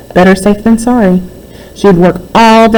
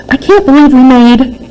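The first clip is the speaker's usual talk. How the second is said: high excited squeal, climbing higher; 8 semitones higher than usual. Acted. Megan squeals to Jessie about their profits, high and excited. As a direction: squeal with disbelief at the money. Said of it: "squealed Megan".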